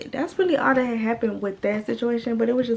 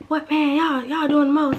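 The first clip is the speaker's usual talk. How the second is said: higher, mildly put-on guy's complaint; exasperated protest; 5 semitones higher, 5 dB louder than usual. Storyteller imitating him sitting there, exasperated. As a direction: imitate him fed up with the fuss. Acts out his annoyed reply.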